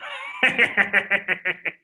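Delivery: long put-on cackle; drawn-out fake gleeful laughing; mock laugh. Prospect absurdly delighted by no sleep, mock laugh.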